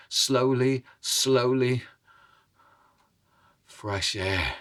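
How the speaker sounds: usual speaking voice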